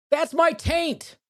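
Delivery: big high announcer voice. Acted. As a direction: announce the segment title grandly. Announcing segment title.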